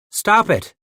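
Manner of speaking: loud high holler. Acted. Paddock yelling at the man pinned beneath him, holler. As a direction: bellow it down at him. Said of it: "Paddock hollered down".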